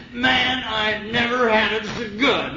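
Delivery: retold tearful cry, high and drawn out; choked up. Big drunk crying before the meeting, mildly choked up. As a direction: lightly imitate a big man overcome with happy tears. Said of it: "he's all choked up".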